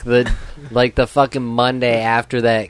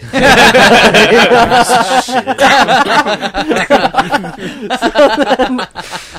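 long, loud, high laughing fit; a long hearty burst of laughter; 12 semitones higher, 7 dB louder than usual. Real, not acted. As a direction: crack up loudly at your own story. Long laugh after story.

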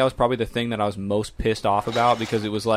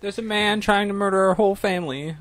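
put-on panicky kid; 9 semitones higher than usual. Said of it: "kid calling mom".

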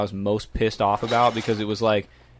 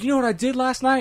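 high taunting voice; 13 semitones higher than usual. Retold or imitated. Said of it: "he's like".

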